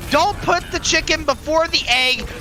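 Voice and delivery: high, urgent live match call; excited play-by-play. Real, not acted. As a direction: exclaim as the fight swings back.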